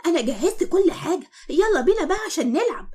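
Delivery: put-on high, eager small boy calling her to play; little boy voice. Little Iyad calling Eshq to come play, high and eager. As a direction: play a little kid begging to go play. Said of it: "وبيقول لها".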